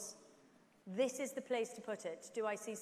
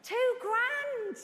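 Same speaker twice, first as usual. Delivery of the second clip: excited shout; 10 semitones higher, 7 dB louder than usual. Real, not acted. Auctioneer cheering a high bid.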